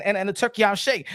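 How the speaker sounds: usual speaking voice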